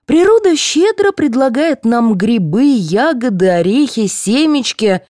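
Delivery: high, lilting oratory; preachy. A narrator's reading. Dentist listing nature's gifts, preaching. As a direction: proclaim the list item by item. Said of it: "вещала стоматолог".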